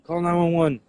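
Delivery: yelling for someone to call nine one one; retold screaming. Running inside after the shooting, yelling for help. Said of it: "I was like".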